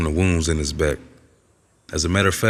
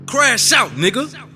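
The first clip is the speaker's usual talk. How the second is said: egging him on; slower than usual. Acted. Inner voice goads him to crash out, high urgent shout. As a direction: shout it like a hothead goading a friend.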